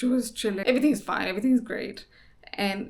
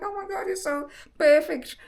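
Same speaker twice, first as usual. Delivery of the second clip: tender, teary awe; 9 semitones higher than usual. Retold or imitated. Gazing at newborn, moved to tears.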